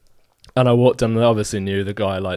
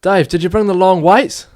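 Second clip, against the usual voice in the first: higher and louder, fake alarmed; mock urgent workmate; 10 semitones higher, 9 dB louder than usual. Builder John winding up the new apprentice, higher mock alarm. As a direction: call out to Dave as if it's urgent. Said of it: "and John says".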